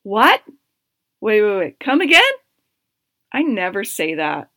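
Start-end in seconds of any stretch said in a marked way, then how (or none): 0.0-2.2 stunned surprise. Retold or imitated. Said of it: "And I was like".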